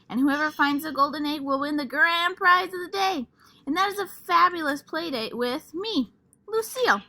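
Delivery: high, loud showing-off voice; excited boasting. Acted. Lucille brags to the class about her play date, high and gushing. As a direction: brag about the prize, bursting with glee.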